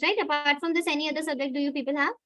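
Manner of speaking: ordinary talk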